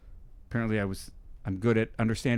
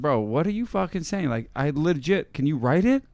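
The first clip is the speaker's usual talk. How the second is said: baffled plea; 6 semitones higher, 5 dB louder than usual. Retold or imitated. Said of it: "I was like".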